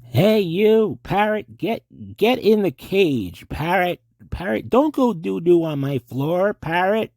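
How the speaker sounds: high, loud tough-cop shouting at a parrot; Beretta scene. Acted. Asked to do a Beretta scene, a loud high tough-cop voice. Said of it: "do a scene from Beretta".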